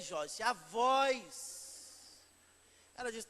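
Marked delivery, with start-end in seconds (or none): 0.0-1.6 slow, lower, as if hypnotised; dazed helpless reply. Retold or imitated. Husband explaining himself to his wife, slow and dazed. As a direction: drag it out like a man in a trance. Vandinho answers, dazed, at checkout.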